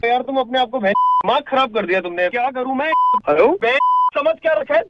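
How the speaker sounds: angry rant. Real, not acted. Husband yelling at his wife on a call, furious. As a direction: yell abuse down the phone.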